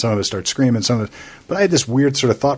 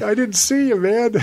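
much higher, exaggerated innocent whine; mock innocent; 9 semitones higher than usual. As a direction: play the over-the-top innocent driver. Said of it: "I was like".

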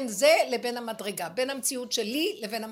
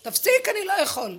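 louder, higher pleading cry; Judah pleading; 7 semitones higher, 7 dB louder than usual. Acted. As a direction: beg him to stop, at the end of strength. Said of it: "יהודה עוד אומר".

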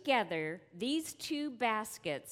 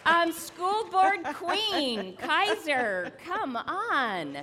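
excited; 7 semitones higher, 6 dB louder, slower than usual. Real, not acted. Hailing the winner.

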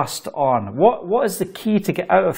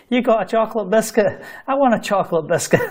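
laughing; 6 semitones higher, faster than usual. Real, not acted. Teasing a viewer about biscuits.

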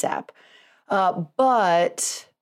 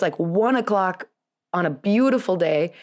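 ordinary delivery

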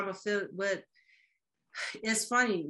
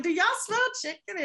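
high, loud, quick disbelief; amazed question; 13 semitones higher, 5 dB louder, faster than usual. Retold or imitated. Newcomer asking family about the smell, loud and amazed. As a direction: blurt it out amazed. Arriving in town smelling chicken.

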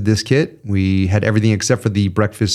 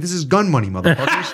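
laughing while retelling his comeback; laughing retort; 6 semitones higher than usual. Retold or imitated. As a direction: crack up as you deliver the punchline. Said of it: "I'm like".

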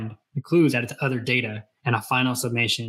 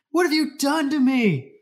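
much higher, demanding cry; 13 semitones higher than usual. A narrator's reading. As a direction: confront his mother, pitched way up. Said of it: "he demanded".

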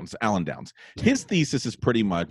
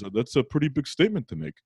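slightly higher, doubting Brooklyn father; Brooklyn detective dad; 4 semitones higher, faster than usual. Retold or imitated. Brooklyn dad doubts son's announcement, lightly imitated and wary. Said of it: "he talks like a detective from Brooklyn".

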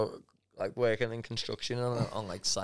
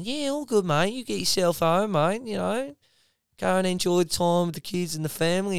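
breezy, high and matey on the radio; high mock boss voice; 8 semitones higher, 3 dB louder than usual. Boss on the radio releasing a worker, high and breezy. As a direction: pitch it up as a cheerful foreman. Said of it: "be Jono for five minutes".

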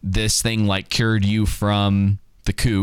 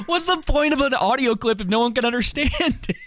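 laughing. Real, not acted. Laughing protest at German clip.

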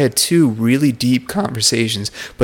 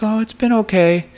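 much higher, light imitation of a coworker; polite brush-off; 12 semitones higher than usual. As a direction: voice her reply in a high, put-on pitch.

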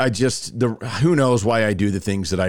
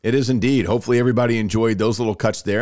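ordinary delivery

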